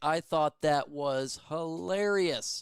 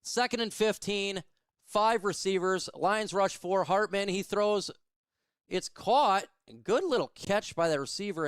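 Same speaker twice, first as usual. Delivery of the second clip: brisk, lightly raised call of each snap; live play-by-play; 4 semitones higher than usual. Acted. Fan calling the game for his stream, play-by-play style. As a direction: narrate the play like a radio caller.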